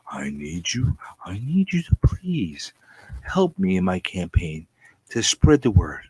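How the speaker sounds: ordinary talk, nothing marked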